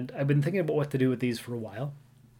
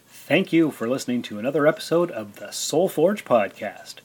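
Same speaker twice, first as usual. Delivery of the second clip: louder, upbeat podcast outro read; announcer read; 5 dB louder than usual. Acted. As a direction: read it like a show sign-off.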